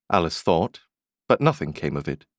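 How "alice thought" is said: ordinary delivery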